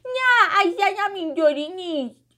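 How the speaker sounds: very high, loud mock crying; tearful wail. A narrator's reading. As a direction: wail like an upset little girl. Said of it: "با ناراحتی زد زیر گریه". (با گریه و ناراحتی)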